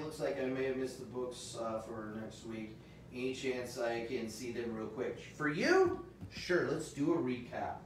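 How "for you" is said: playful surprise. Real, not acted.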